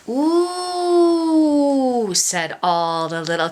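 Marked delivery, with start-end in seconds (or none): 0.1-2.1 high, loud drawn-out ooh of wonder; awed ooh. Acted. Little crocodiles hear the reward, a loud high ooh. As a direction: delight at going home.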